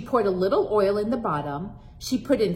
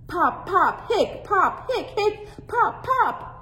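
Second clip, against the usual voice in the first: popping sound effects; 11 semitones higher, slower than usual. Acted.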